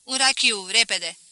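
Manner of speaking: loud, curt command; barked order. A narrator's reading. Merchant ordering his wife about like a servant, loud command. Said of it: "îi porunci nevestei ca unei slugi".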